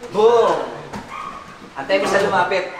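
usual speaking voice

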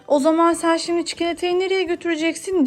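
ordinary talk

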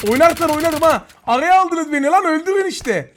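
heated taunt. Real, not acted. Gamer caught in a crossfire taunts enemies, heated and loud. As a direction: snap back at the attackers.

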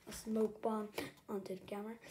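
ordinary delivery

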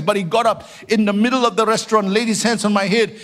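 normal talking voice

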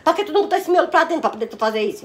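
high, loud outraged questioning; indignant scolding. Real, not acted. Mãe repreende a filha indignada. (esculacho indignado, voz aguda)

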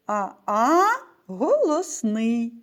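ordinary talk, nothing marked

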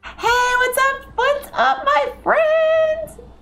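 very high, slow, singsong greeting; high singsong hello to chat; chirpy greeting. Real, not acted. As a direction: sing out a hello to a viewer.

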